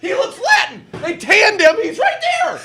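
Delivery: loud high excited pointing; high mock shout. Accuser pointing out the hiding boss, high mock shout. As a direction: squeal it out like you spotted him.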